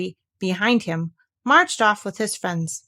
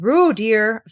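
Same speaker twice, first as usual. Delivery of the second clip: quick interruption; 6 dB louder than usual. A narrator's reading. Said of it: "very quickly".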